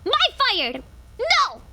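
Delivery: high, mock-frantic warning off; playacted possessive protest; fire guarder. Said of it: "she's like".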